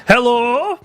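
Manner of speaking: high, cheesy rising hello; mock Norwegian presenter. Mocking the Norwegian guy at BlizzCon, high cheesy voice. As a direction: parody an awkward keynote host. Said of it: "Yeah, he's like".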